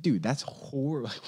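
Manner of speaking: normal talking voice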